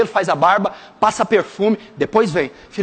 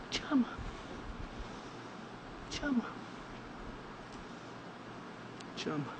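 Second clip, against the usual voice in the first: fading whisper; 25 dB quieter, faster than usual. Real, not acted. Repeating softly, fading out.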